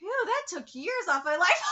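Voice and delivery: ordinary delivery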